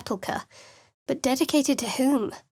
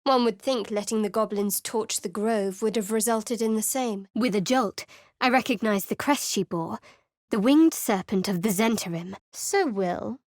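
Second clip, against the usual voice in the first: ordinary talk, nothing marked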